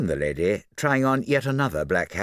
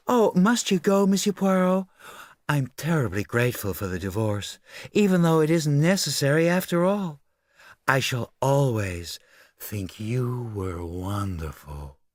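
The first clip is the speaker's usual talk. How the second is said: gushing socialite; 6 semitones higher than usual. Acted. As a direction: gush a farewell, melting at the end.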